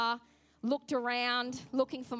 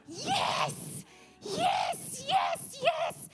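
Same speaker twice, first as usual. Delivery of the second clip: mighty yes yell; much slower than usual. Retold or imitated. Preacher retelling finding his laptop, high joyful yelling. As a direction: shout yes in triumph. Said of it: "I yelled out a mighty yes".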